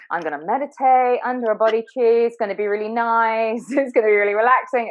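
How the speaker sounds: dreamy naive seeker. Retold or imitated. Mocking naive dreamy meditator.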